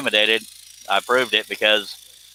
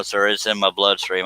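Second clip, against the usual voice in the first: usual speaking voice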